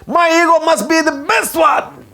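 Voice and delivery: high, hammed-up egotistical cry; defiant mock shout. Preacher voicing the proud inner ego, mock shouting. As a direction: proclaim it as a puffed-up ego.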